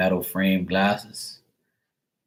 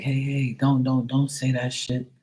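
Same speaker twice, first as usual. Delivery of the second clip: higher-pitched imitation of someone cautioning; mock "hold him back" interjection, pitched up; restraining; 6 semitones higher than usual. Acting man holding grandfather back.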